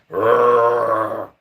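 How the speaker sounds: loud growling bear noise; mimicking the bear at the cabin, growling. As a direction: growl it out like a big brown bear.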